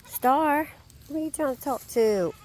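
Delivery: high, loud call to the animal; calling to Star. Real, not acted. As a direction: call over to Star, teasing.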